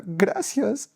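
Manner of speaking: high, weeping thanks; crying gratitude to God, pitched high; tearful prayer. Retold or imitated. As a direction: pray through tears. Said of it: "solo podía llorar y decía".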